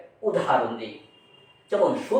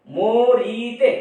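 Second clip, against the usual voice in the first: "মরিতে" stretched very slowly with a rise; "মরিতে" sung out slowly syllable by syllable; drawn out; 4 semitones higher, 6 dB louder, much slower than usual. Shown as a demonstration. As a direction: pull "মরিতে" out long and slow, rising at the end. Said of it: "আমি আগেই বলেছি".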